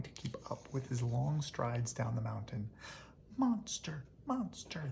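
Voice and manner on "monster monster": high calling cheer. Acted. The rascals chase the monster down the mountain, high and cheering. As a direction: call after him with glee. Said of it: "they cheered after him".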